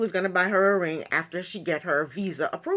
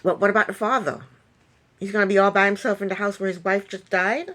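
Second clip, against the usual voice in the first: ordinary delivery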